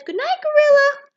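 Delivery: very high, loud little mouse. A narrator's reading. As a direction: squeal it out as a tiny mouse. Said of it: "Mouse says".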